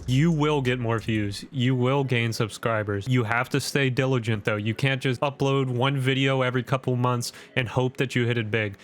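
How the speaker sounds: ordinary delivery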